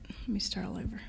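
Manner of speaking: whispered aside to oneself; very soft, nearly voiceless mutter. Real, not acted. Muttering while fiddling with slides.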